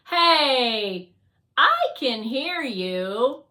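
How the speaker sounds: loud, drawn-out objection; offended protest. Acted. Fly overhears Guardian's complaint about drones, loud indignant protest. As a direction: call out the insult indignantly.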